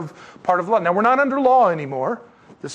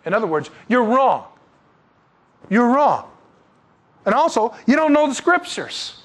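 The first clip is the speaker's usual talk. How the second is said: Jesus rebuking; 8 semitones higher than usual. Retold or imitated. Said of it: "and Jesus said".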